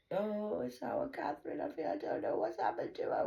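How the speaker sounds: high breathy fretting mother voice; mocking mother. Mocking the mother who claims to worry, a high fretting voice. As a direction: whine it high like a fake-worried mother.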